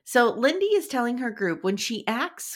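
ordinary delivery

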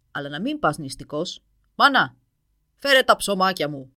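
booming but friendlier giant; a gruff giant turning kind; kinder dragon. A narrator's reading. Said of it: "με πιο καλοσυνάτο ύφος". (πιο καλοσυνάτη βροντερή φωνή δράκου)

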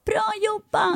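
put-on high, far-off old lady voice; cheering old ladies. Old ladies cheer the narrator on, high and sweet. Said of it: "de bara".

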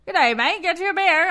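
put-on Aussie outback accent. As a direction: play an outback local greeting a tourist in a hammed-up Australian accent. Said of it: "that was a great Aussie outback accent".

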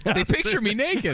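mock Andrea. Voicing Andrea mockingly.

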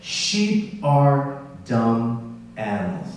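very slow, spaced-out words; deliberate quotation. Retold or imitated. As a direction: drop each word separately, with weight. Repeats the quote for effect.

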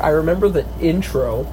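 normal talking voice

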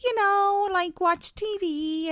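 high, breezy fake innocence; innocent cover story. Hypnotized agent fibbing to friends, high and breezy. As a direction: brush it off sweetly as if nothing happened. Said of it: "she's like".

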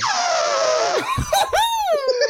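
loud high trumpeting elephant call; elephant impression. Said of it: "wanna hear my elephant".

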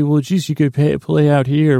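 normal talking voice

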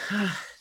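loud acted exhale of relief; weary sigh. Barkeep after the brawl ends, heavy sigh. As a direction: let out a big barkeep's sigh.

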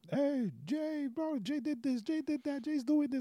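very high, excited hype voice; hyped-up friend impression. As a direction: shout his name like a hype man. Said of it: "he'll always, like, blow it up".

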